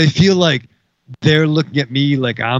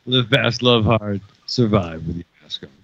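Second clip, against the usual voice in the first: low dramatic voice; 7 semitones lower, 4 dB quieter than usual. Pretend. Dramatic sign-off motto.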